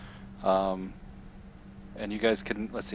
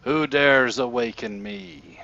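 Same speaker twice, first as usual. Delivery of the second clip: slow, louder, higher undead king voice; booming skeleton king; 4 semitones higher, 6 dB louder, slower than usual. Acted. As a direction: boom it out like a risen king. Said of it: "you hear in your heads".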